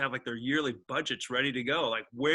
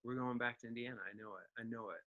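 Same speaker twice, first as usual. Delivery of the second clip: 1 resigned murmur; 3 semitones lower, 15 dB quieter, faster than usual. Retold or imitated. Resigned inner reply, very soft.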